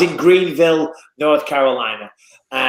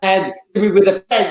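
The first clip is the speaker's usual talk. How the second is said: normal talking voice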